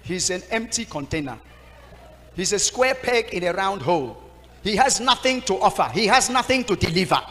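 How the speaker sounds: put-on loud, dismissive rant about the boy; scornful complaint. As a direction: pile on the insults, rising. Choir mistress rejects the young pastor.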